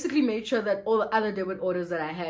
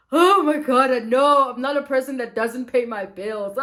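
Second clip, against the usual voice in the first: emotional outburst; 3 semitones higher, 8 dB louder than usual. Retold or imitated. Woman pleading with the debit order lady on the phone, overwrought. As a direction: re-enact being overwrought, loud and half-laughing. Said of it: "I was so emotional over the phone".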